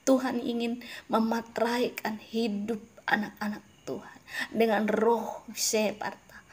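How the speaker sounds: normal talking voice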